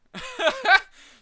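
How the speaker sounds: sudden loud high laugh. Real, not acted. After telling his mom story, loud burst of laughter.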